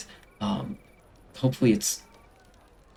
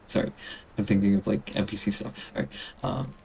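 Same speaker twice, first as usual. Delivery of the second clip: ordinary talk, nothing marked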